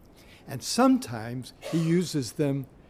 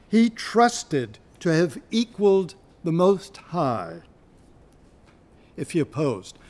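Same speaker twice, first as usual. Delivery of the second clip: poetry reading; 2 semitones higher, 4 dB louder than usual. Shown as a demonstration. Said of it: "Look at line forty".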